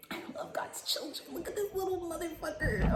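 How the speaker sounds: cooing at pet. Real, not acted. Cooing at tiny dog.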